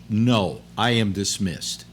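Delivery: ordinary talk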